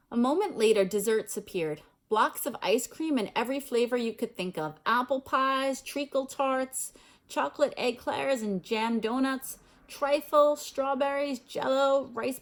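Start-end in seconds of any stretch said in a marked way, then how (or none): none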